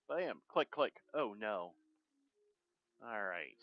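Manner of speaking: ordinary delivery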